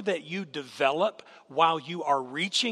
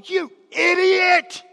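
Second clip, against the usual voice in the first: sudden hammed-up yell, much higher and louder; mock scream at dog; 13 dB louder, slower than usual. Preacher screaming at his dog, mock furious yell. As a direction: bellow an insult at the dog for show. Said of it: "You can scream at your dog".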